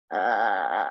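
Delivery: deep, low breathy ahh with tongue out; lion breath ahh. Shown as a demonstration. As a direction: push out a lion's roar breath. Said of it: "J'étire la langue".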